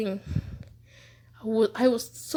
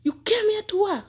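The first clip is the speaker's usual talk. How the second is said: madam's shout; 8 semitones higher than usual. Retold or imitated. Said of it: "She would just shout".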